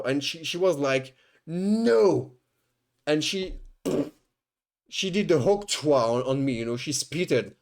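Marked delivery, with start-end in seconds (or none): 1.5-2.3 high, emphatic retold refusal; acted refusal. Old patient turns down the blood test, acted refusal. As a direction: refuse outright on a raised pitch.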